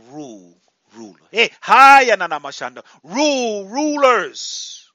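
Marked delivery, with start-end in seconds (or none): 1.3-2.2 a loud ecstatic burst of syllables; tongues outburst. Real, not acted. Preacher breaks into tongues. 2.2-2.8 ecstatic syllables; speaking in tongues. Real, not acted. 3.0-4.9 higher and louder, a ringing command; commanding cry. Real, not acted. Commanding the congregation to rule.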